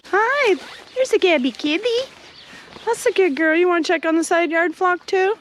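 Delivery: high sing-song greeting; pet talk. Real, not acted. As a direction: coo up high at the kitty. Greeting the cat.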